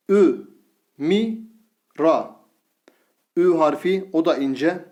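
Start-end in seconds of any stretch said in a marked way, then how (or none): 0.1-2.4 Vü said with thin Ü, each syllable drawn out; syllable drill with thin vowel. Shown as a demonstration. Teacher reads the thin-vowel syllables to students, slowly. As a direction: read out each syllable apart. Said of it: "Mim harfimiz ince bir harf".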